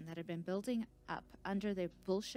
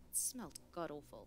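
usual speaking voice

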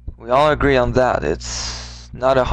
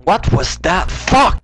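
sharp high yell of frustration; angry outburst; 7 semitones higher, slower than usual. Real, not acted. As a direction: yelp it out in anger. Sudden fail in game.

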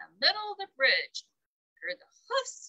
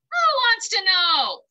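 much louder, higher snarl; 8 semitones higher, 10 dB louder than usual. A narrator's reading. Rough snarling at the little ogre below. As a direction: snarl it at him. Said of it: "snarled Rough".

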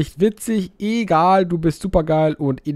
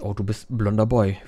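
ordinary talk, nothing marked

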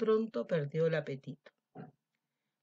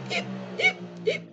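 lamb hiccups; 6 dB louder, slower than usual. Acted.

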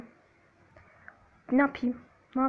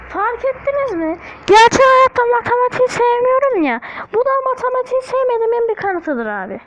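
much higher and louder, mock outrage at math; exasperated shout; 10 semitones higher, 9 dB louder than usual. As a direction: yell your complaint at the audience. Outburst about hating math. (matematiğe sinirlenmiş, yüksek sesle bağırarak)

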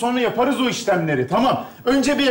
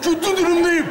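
higher, whiny mock crying plea; mock desperate whine; 5 semitones higher than usual. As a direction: beg like a hammed-up desperate boyfriend. Desperate boyfriend begging to go home.

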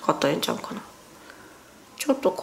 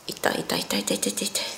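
real pained whisper, barely voiced; whispered ouch; 5 semitones lower, 10 dB quieter than usual. Real, not acted. Peeling surgical tape off her nose, whispering in real pain. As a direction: breathe the ouches out under your breath as the tape pulls.

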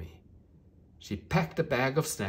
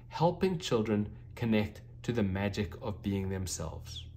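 ordinary delivery, nothing marked

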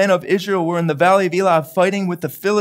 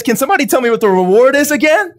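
quick, higher, louder eager asking; eager question; 5 semitones higher, 5 dB louder, faster than usual. Retold or imitated. David asking the soldiers about the reward, eager and fast. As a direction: lean in, hungry for the prize. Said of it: "He says".

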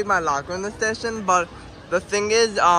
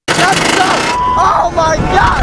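loud, high, drawn-out screams; screaming; 9 dB louder, much slower than usual. Real, not acted. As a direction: scream through the drop. Screaming on the coaster drop.

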